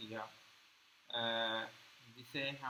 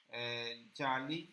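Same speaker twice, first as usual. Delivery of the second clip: slow drawn-out جعلی; much slower than usual. Shown as a demonstration. Teacher to students, drawing out جعلی slowly. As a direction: stretch جعلی slowly, syllable by syllable. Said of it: "جعلی معنی ہوتا ہے فرضی".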